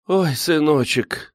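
high, drawn-out motherly lament; sorrowful sigh. A narrator's reading. Anna Grigoryevna sighing to the young man, sorrowful. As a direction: sigh it out slowly with grief. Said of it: "горестно вздохнула Анна Григорьевна".

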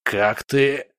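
strained, halting question; hoarse croak. A narrator's reading. Spirit questions newcomer's arrival, croaking. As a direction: croak out disbelief. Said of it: "прохрипел он".

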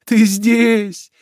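high, loud, overwhelmed cries; emotional, rising exclamations of joy; trembling voice. A narrator's reading. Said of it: "говорил дрожащим голосом Джальма".

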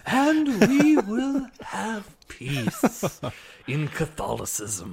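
very slow, drawn-out Sith lord voice; Emperor Palpatine. Acted. Old pope as Sith Lord, creepy intoning. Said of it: "Emperor Palpatine".

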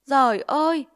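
loud high wail of despair; mother crying out at the bribe; anguished cry. Acted. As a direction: cry out to heaven in shock. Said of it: "Mẹ tôi rú lên".

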